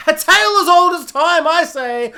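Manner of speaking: high, loud hammed-up declaration; mock grand proclamation. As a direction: proclaim it grandly, then laugh. Grand mock repeat of cliché.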